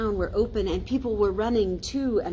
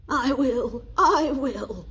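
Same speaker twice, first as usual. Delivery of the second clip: louder, higher called promise; raised calling voice; 7 semitones higher, 5 dB louder, slower than usual. A narrator's reading. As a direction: shout it after the spirit. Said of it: "called Scrooge".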